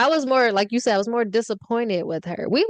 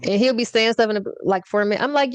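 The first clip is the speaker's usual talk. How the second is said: usual speaking voice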